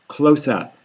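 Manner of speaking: ordinary delivery, nothing marked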